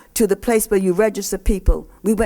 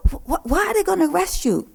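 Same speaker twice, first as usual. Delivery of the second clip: worried policewoman; 9 semitones higher than usual. Retold or imitated. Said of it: "She said".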